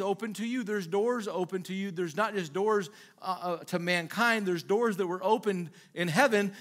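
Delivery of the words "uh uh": ordinary delivery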